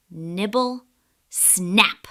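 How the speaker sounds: ordinary delivery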